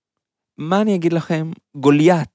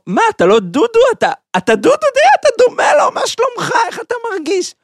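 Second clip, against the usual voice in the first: high, loud, rushed fake surprise; mock astonished friend; 6 dB louder, faster than usual. Prankster faking delight at the bald giant after slapping him. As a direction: gush like you just spotted a long lost buddy.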